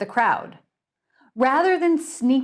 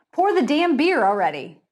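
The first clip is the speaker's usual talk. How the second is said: impatient; 5 semitones higher, 4 dB louder than usual. Acted. Impatient snarl continues.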